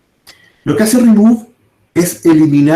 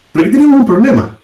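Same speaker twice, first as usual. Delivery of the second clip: dramatic alert; 6 semitones higher, much faster than usual. Real, not acted. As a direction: sound the alarm to the class. Teacher flags a problem dramatically. (advertencia rápida y aguda)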